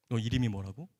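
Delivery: low, measured question; lightly voiced professor. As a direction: lightly act a surprised senior professor. Professor questioning the trembling student.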